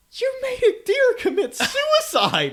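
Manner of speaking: high, loud and laughing, slowed; laughing accusation. Retold or imitated. Friends teasing Jeff about the deer, laughing accusation. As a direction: deliver it while cracking up. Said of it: "And they're like".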